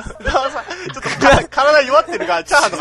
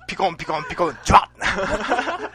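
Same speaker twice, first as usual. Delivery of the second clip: Ultraman sound effect; 2 semitones higher than usual. Acted.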